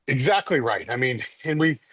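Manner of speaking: ordinary talk, nothing marked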